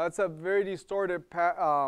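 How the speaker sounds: ordinary talk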